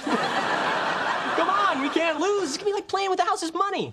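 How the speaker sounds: high, eager coaxing; excited pleading. Acted. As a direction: talk them into it at a squeaky pitch. Pleads with partner to have sex.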